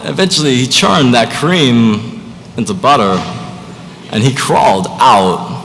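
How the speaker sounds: slow, halting impression with stretched key words; deliberate, oddly paced celebrity impression; Christopher Walken. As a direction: tell it as a drawn-out storyteller with odd pauses. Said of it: "best Christopher Walken impression".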